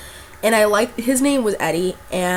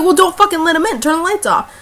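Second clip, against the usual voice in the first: much higher, louder urgent command; hiss-free frantic plea, pitched way up; frantic order; 8 semitones higher, 6 dB louder than usual. Retold or imitated. To friend about to open the door, loud frantic order.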